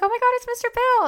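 high-pitched, quick imitation of an excited fan. Girl spotting a minor celebrity at breakfast, imitated excited squeal.

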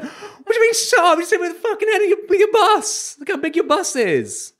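very high, fast outraged shouting; furious mock yell. Retelling shouting back at the rude bus driver, high and outraged. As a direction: squeal it in exaggerated disbelief.

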